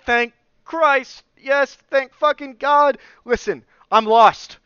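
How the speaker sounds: loud, high, drawn-out outburst; desperate relief. Retold or imitated. Lost traveler finds fellow Americans, desperate relief. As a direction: blurt it out with exaggerated relief.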